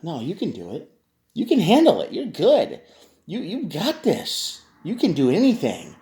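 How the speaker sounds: pushy cheerleading. Retold or imitated. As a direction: mimic a friend egging you on. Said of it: "They're the energy that says".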